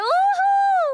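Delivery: excited cheer. Real, not acted. Celebrating performance.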